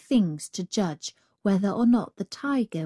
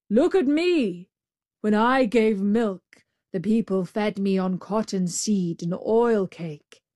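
lightly voiced complaining buffalo; embittered buffalo. Buffalo at the well scolding the Brahmin, embittered. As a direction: grumble emphatically in an altered voice.

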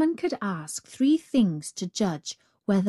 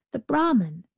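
plain reading voice

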